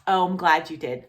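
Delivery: plain reading voice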